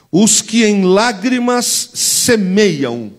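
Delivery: slow, weighty reading of the verse; slow scripture reading. Shown as a demonstration. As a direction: read each word slowly for the church to repeat. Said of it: "Repete comigo".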